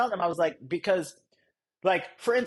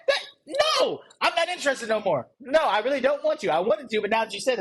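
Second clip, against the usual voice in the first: turned-off refusal; 6 semitones higher than usual. Retold or imitated. Mocking woman's turned-off reply.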